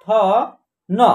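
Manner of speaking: usual speaking voice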